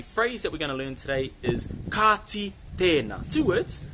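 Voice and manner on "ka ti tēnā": slow, drawn-out long vowels in "Ka ti tēnā"; lange klinkers. Shown as a demonstration. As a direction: stretch the vowels of "Ka ti tēnā", word by word. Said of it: "there's some long vowel sounds in there".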